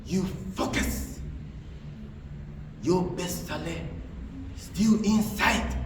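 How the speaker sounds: slow, hushed near-whisper; voice of the heart. Acted. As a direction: speak slowly and softly, like an inner voice. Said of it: "That's just gonna say to you".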